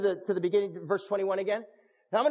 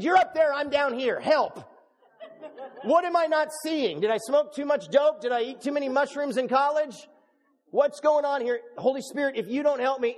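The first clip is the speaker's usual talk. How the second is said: frustrated plea; 4 semitones higher than usual. Retold or imitated. Preacher re-enacts begging the Holy Spirit for help, frustrated plea. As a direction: plead upward in hammed-up exasperation.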